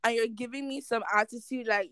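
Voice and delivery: ordinary talk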